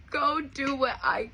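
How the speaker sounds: choked up, real tears on each word; crying. Real, not acted. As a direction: repeat it as tears take over. Overcome repeating mom's words.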